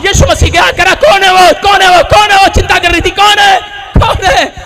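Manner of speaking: high, loud, urgent demanding; impassioned preaching. Acted. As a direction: build the scene with ringing repeated challenges. Preaches Jesus confronting the mourners. (ऊँची आवाज़ में जोशीला प्रचार)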